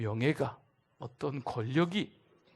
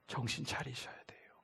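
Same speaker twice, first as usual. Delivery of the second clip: sudden whisper after the shouting; urgent whispered rebuke; whispered warning; 9 dB quieter than usual. Acted. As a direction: hush it like a warning. Sudden drop after loud preaching.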